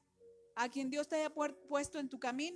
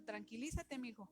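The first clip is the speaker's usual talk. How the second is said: gentle calming; 3 semitones lower, 6 dB quieter than usual. Pretend. Voices the Holy Spirit calming.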